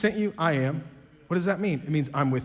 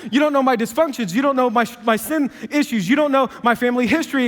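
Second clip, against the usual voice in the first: much higher, louder list of complaints, rushing at the end; loud excuses; 6 semitones higher, 7 dB louder than usual. Retold or imitated. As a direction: fire off every excuse at God. Listing everyone's excuses to God.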